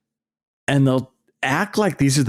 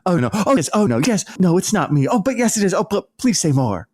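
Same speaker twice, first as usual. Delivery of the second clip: high, sing-song mock coyness; fake modesty; 9 semitones higher, faster than usual. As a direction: bat the compliments back and forth, hammed up. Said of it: "they do that fake modesty thing".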